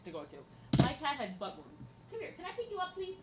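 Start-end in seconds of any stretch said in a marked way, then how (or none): 2.1-3.1 much higher, softer plea to a pet; coaxing the cat. Real, not acted. Streamer asking her cat to be picked up, high and soft. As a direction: gently coax the cat up.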